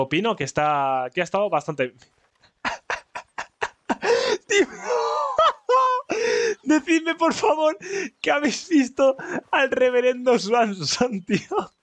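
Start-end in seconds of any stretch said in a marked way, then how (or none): 4.5-11.7 high laughing shriek into words; laughing hard. Real, not acted. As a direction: laugh till it squeaks. Laughing at reverend falling. (riéndose a carcajadas, agudo)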